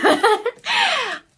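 happy giggle. Real, not acted. Gushing about pumpkin candle.

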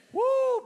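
a very high, loud whoop; joyful whoop. Real, not acted. Pastor whooping with joy after the declaration. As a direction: let out a celebration cry.

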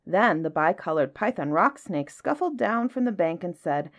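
ordinary delivery

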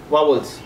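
वावल्स with a short second vowel, clearly; correct pronunciation. Shown as a demonstration. Teacher demonstrating the correct vowel sound. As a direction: say वावल्स the right way. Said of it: "सही जो स्वर है दोस्तों वो है वावल्स".

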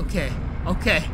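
ordinary talk

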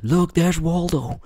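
high mock shout of a stranger on the street; mocking passerby. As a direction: point and call out like spotting him in a crowd. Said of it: "people crying like".

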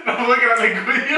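laughing. Real, not acted. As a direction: tease through laughter. Teasing friend who keeps laughing.

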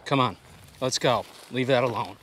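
loud urgent command; calling the dog. Real, not acted. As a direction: call the dog off sharply.

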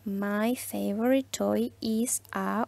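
drawn-out answer frame for the class; slow model answer. Shown as a demonstration. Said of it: "respondíamos a esto con". (respuesta modelo muy lenta y suave)

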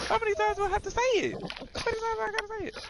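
squeaked way up in disbelief; exasperated high pitch. Real, not acted. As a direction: pitch it high, fed up and laughing. Exasperated laughing repeat.

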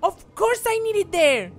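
high, loud frantic cry; frantic yelling. Real, not acted. Gamer yelling in panic at the rope puzzle.

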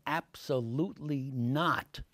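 ABSOLUTELY drawn out slowly, each syllable separate; slow, measured "Absolutely not", every beat stressed; emphatic refusal. Retold or imitated. Network head's firm refusal, retold.

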